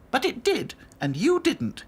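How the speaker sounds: high, brisk doll voice; reassuring Dotty. A narrator's reading. Dotty reassuring Princess, firm.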